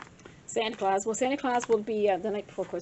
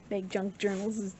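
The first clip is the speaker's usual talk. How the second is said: ordinary talk, nothing marked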